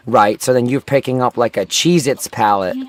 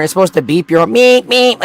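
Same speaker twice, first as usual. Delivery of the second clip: high cartoon meep meep, squeaking up; Roadrunner meep; 7 semitones higher, 4 dB louder than usual. Mocking forklift horns with a high cartoon meep. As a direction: chirp it like the cartoon bird. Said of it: "like a fucking Roadrunner".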